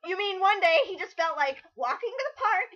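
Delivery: ordinary delivery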